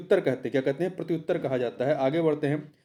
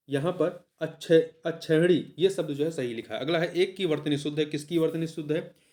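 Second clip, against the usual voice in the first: ordinary talk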